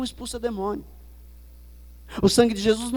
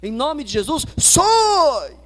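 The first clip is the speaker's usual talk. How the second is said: higher and louder, yelled SAI mocking the crente; mock exorcist yell; 5 semitones higher, 5 dB louder, much slower than usual. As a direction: bark the final sai like a showy exorcist. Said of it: "presta atenção nesses crentes aí".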